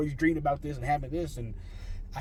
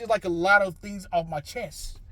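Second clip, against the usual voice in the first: usual speaking voice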